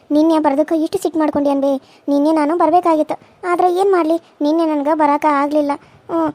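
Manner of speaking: ordinary talk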